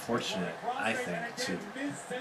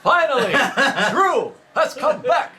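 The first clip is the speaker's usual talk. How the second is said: The Rock; 8 dB louder than usual. Retold or imitated. Friend repeating the encore for the lady, booming wrestler mimicry. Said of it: "doing the Rock impression".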